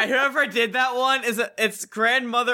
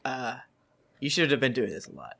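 low mocking voice; 9 semitones lower, 6 dB quieter than usual. Mocking the group's voice.

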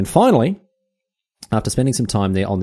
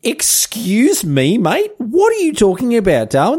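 much higher, drawn-out indignant squawk; scandalised Aussie taking offence; mock outrage; 12 semitones higher, slower than usual. Host answers Darwin's insult to Australia, in hammed-up outrage.

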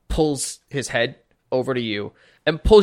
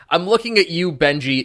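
usual speaking voice

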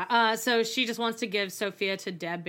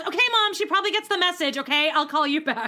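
louder, irritated brush-off; annoyed snap; 5 semitones higher, 4 dB louder than usual. Retold or imitated. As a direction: cut her mom off impatiently. Farrah snapping at mom.

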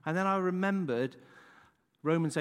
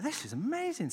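mock thrilled, much higher; excited neighbours; 9 semitones higher than usual. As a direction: gush like the amazed villagers. Neighbours marvel at horses.

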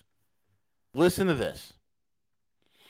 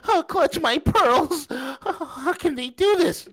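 mock pearl-clutching; 10 semitones higher, slower than usual. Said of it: "many of you are like".

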